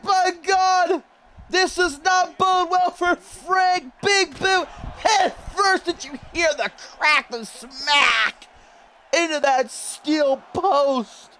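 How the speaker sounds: high, shouted alarm held up high; shocked shout. Real, not acted. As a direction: yell in shock at the crash. Wrestler slammed into post.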